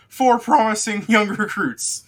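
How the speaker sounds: very high, loud proclamation; announcing officer. Acted. Officer recounting the new recruits, loud high announcement. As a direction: correct the count in a high, booming announcement. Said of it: "he looks and he recounts".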